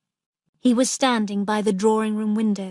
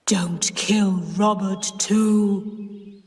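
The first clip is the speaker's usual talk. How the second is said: deep terrible voice; much slower than usual. Acted. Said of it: "in a deep, terrible voice".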